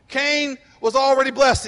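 BLESSED shouted out, loud and high; preaching shout. Real, not acted. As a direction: preach it with full force.

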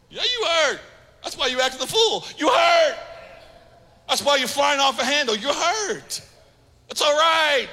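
high, driving preacher cadence; preaching at full force. Acted. Pastor urging the congregation to admit their hurt, preaching hard and high. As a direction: hammer the point home to the pews.

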